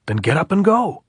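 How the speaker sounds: high, loud command; captain barking at the sniper; angry order. A narrator's reading. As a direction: order him up the hill.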